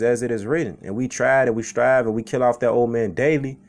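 ordinary delivery

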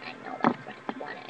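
very soft, quick whisper; whispered aside. Real, not acted. Admitting the friends want candy, whispered aside. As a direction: drop into a hurried whisper.

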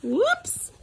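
high whoops; cried out. A narrator's reading. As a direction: squeak it in surprise. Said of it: "cried Froggy".

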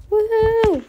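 high, loud woohoo; excited cheer. Pretend. Kid cheering.

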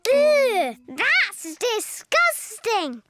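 slow disgusted groan. Acted. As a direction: drag out the disgust. Reacting to a sneeze.

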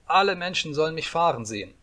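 put-on high, insistent little-boy demands; demanding cry. A narrator's reading. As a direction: call it out like a bossy child. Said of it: "schrie Häwelmann". (forderndes Kindergeschrei)

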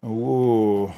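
usual speaking voice

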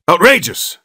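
high, loud single clipped word; indignant doctor snaps one word; snapped out. A narrator's reading. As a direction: spit it out in one syllable. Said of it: "He snapped the last word out in almost one syllable".

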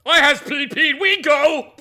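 loud, high oafish announcement; dim-witted brute. Acted. Dim companion demanding to go, loud and childish. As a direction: declare it like a needy toddler.

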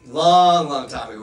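"long" punched out loud and stretched; stress on long. Real, not acted. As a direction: lean hard on the first "long", then let it drop. Emphatic repeat of "long.

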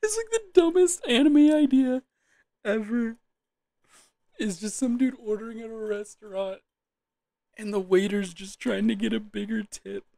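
high, squeezed voice through laughter; real laughing fit, pitch way up; cracking up. Real, not acted. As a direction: explain it while helpless with giggles. Cannot stop laughing at own bit.

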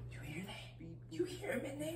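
hushed, breathy whisper. Acted. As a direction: whisper it like something's inside. Whispering about the possessed friend.

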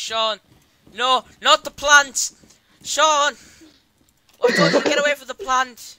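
loud, high shout of warning; alarmed shouting. Real, not acted. Player yelling at Sean to leave the plant, alarmed shouting. Alarmed telling-off of a teammate in game.

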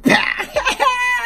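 a burst of high-pitched giggling; giddy high laugh breaking out; high squealing laugh. Real, not acted. Playful take, breaking up.

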